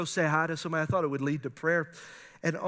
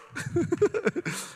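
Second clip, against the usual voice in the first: high laugh; 11 semitones higher than usual. Real, not acted. Pastor laughing after imitating Sharonda, high laugh. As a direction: laugh at your own impression.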